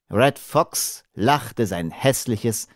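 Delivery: plain reading voice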